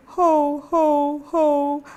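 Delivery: high, loud sobbing "ho, ho, ho"; mock sobbing ho. Shown as a demonstration. Teacher demonstrating a sob to find the tilted larynx. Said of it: "if you sob".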